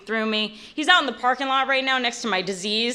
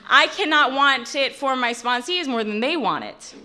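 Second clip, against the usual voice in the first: ordinary delivery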